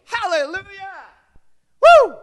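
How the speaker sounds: high, loud Hallelujah then a whoop; exultant shout. Real, not acted. Preacher cheering at the climax, joyful whoop. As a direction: whoop with joy.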